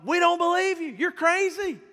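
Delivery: acted-out derisive crowd; mocking scoff. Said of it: "they're laughing and going".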